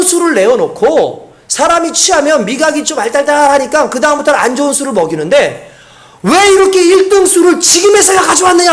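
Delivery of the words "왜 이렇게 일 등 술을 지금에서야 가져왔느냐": higher-pitched indignant scolding; mock scolding. Banquet master scolding the servants, pitched-up mock indignation. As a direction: demand to know why the best wine comes so late. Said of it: "하면서 야단을 치더라는 거예요".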